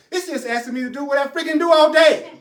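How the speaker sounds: loud, high comic outburst; exclaimed realisation. Acted. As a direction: blurt it out as the student, high and loud. Voices student's exclaimed realisation.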